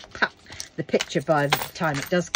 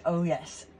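normal talking voice

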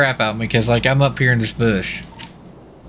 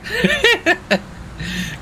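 burst of laughter. Real, not acted. Laughing at partner's driving.

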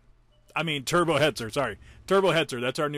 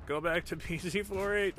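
ordinary talk, nothing marked

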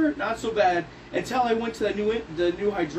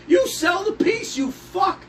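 angry shout; 10 semitones higher, slower than usual. Retold or imitated. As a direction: yell it at the clerk. Acted yell at clerk.

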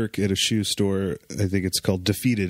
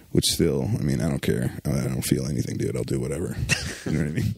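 careless creep voice; 7 semitones lower, faster than usual. Pretend. Playing a careless brother.

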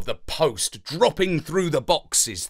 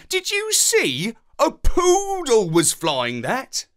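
high, then slower marvelling; amazed; 9 semitones higher, 3 dB louder than usual. A narrator's reading. Dog telling the parrot about the poodle, amazed. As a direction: tell it breathless with wonder. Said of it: "dog marvels".

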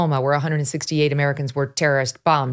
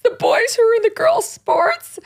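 mocking Jonathan Van Ness's fake cry, high and whiny. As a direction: ham up a teary whine to mock him. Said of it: "I already had to mock somebody's fake cry".

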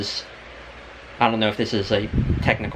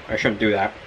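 ordinary talk

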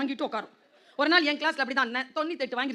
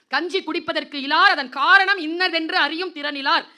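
put-on slow, loud, high declaiming of old verse; verse recitation; 5 semitones higher, 10 dB louder, slower than usual. Speaker quoting poetry to students, slow loud recital.

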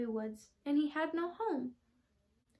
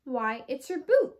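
louder, slow amazed exclamation; surprised exclaiming; 2 semitones higher, 8 dB louder than usual. Acted. Mother discovering the boot as a home, loud and amazed. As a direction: let the surprise land slowly. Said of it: "exclaimed Pokey's mother".

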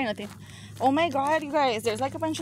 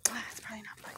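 whispering; 11 dB quieter, faster than usual. Real, not acted. Hushed in a creepy building.

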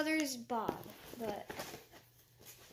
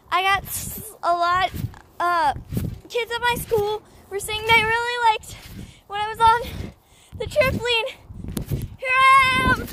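high loud outdoor yelling; loud excited shouting; 9 semitones higher, 12 dB louder than usual. Real, not acted. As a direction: shout over the bouncing with excitement.

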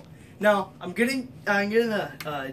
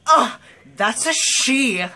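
triggered; 6 semitones higher, 6 dB louder, slower than usual. Pretend. Acting triggered person.

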